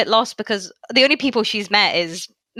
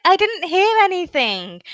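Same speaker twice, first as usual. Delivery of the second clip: put-on higher, innocent girlish voice; Orihime voice; 10 semitones higher than usual. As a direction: play it wide-eyed and clueless, higher. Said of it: "She's like".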